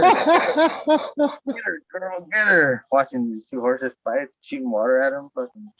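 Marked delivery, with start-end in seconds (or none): 0.0-2.8 high, laughing egging on; laughing cheer. Retold or imitated. Watching two horses fight, laughing and egging on.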